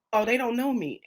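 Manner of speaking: ordinary delivery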